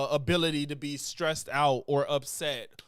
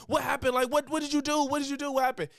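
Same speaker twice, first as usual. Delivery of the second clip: frantic worry; 8 semitones higher, faster than usual. Retold or imitated. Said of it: "my immediate reaction was".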